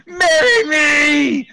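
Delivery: high, loud desperate scream. Acted. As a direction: scream it at the top of your lungs. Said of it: "Screamed Amy".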